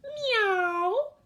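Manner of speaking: high, loud cat's meow; a big high yowl of a cat. A narrator's reading. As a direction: mew like a startled cat. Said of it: "cried Wilbur outside Winnie's door".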